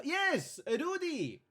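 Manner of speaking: high, loud call to the dog. Acted. Host calls his dog.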